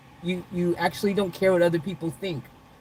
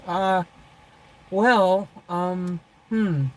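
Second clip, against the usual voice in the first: ordinary talk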